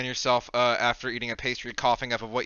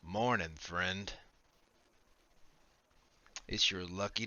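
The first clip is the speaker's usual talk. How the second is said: low, soft, slow telepathic voice; hushed, unhurried mysterious messenger; voice in the mind; 3 semitones lower, 8 dB quieter, slower than usual. Acted. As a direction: speak slowly and quietly into someone's head, with long pauses. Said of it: "then a voice enters your mind".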